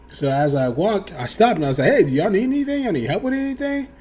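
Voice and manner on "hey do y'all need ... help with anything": friendly offer. Retold or imitated. Offering help to customers.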